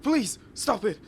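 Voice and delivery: high, loud frantic begging; panicked pleading. Acted. Boy begging his big sister to stop, panicked. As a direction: beg her to stop hitting.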